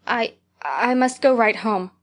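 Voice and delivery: slow, thick-tongued and halting. Acted. Diana, dizzy and thick-voiced, telling Anne she must go home. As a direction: drag the words out thickly, stumbling. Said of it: "she said, a little thickly".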